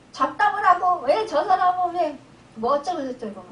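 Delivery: loud, high-pitched mock ranting; self-righteous indignation. Churchgoer ranting about others skipping prayer and worship, acted indignant. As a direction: imitate a fired-up churchgoer reeling off complaints. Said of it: "그냥 내 의분에 차서".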